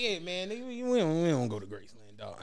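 softly, low: we don't go there; hushed clerk. Retold or imitated. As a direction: drop the voice like it's a secret. Clerk's hushed refusal retold.

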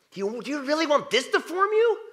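high, loud nagging inner voice; inner critic voice. Retold or imitated. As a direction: needle yourself like a pushy inner critic. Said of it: "That's my inner critic".

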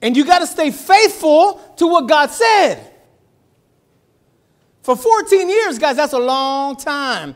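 loud, slow, high-pitched preaching cry; preaching shout. Real, not acted. Pastor preaching to congregation.

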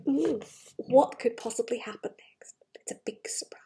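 soft whispered teaser; suspense whisper. Acted. Parent teasing what happens next, whispered.

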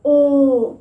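loud drawn-out ओ of surprise; an amazed oh; surprised oh. Shown as a demonstration. Teacher acting out surprise at something happening. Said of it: "हम बोलते हैं ओ".

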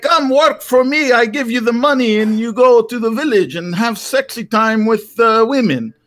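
put-on high, slow Greek-accented uncle; imitating a Greek farmer uncle, slow and high; mock Greek accent of the old uncle; uncle's Greek accent. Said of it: "he's like".

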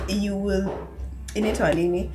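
usual speaking voice

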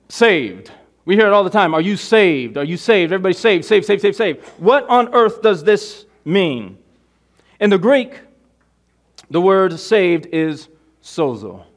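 loud, high-pitched sermon delivery; forceful preaching. Real, not acted. Preacher reading John three to the congregation, full-force preaching. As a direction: proclaim it to the congregation, drawing out key words.

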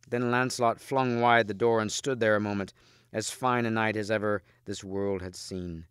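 ordinary delivery

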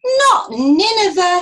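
loud, high, drawn-out whine; moaning protest. A narrator's reading. Jonah refusing God's order to go to Nineveh, moaning. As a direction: wail it out in dismay. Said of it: "He moaned".

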